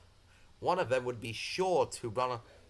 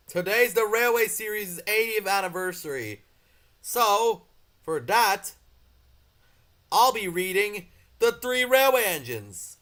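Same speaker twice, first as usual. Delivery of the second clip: excited announcement; 8 semitones higher, 8 dB louder than usual. Real, not acted. Anniversary announcement.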